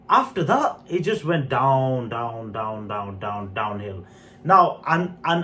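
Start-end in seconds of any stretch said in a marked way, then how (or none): 1.5-4.0 sinking downward. Real, not acted. Reviewer lamenting the film's second half, voice sinking away. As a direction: voice sliding steadily lower and softer. Mimics the story's steady decline.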